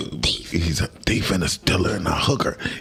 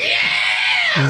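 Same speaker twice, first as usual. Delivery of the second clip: a big, high, loud "Yeah!" shout; crowd cheer; 4 dB louder than usual. Retold or imitated. Crowd answering a Philly shout-out with a loud cheer. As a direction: cheer it like the whole room agrees. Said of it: "They're like".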